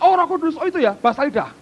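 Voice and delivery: high, scornful mimic of a doubter; lightly mocking churchgoer brushing off the Holy Spirit; mocking scoffer. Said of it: "mesti berkata".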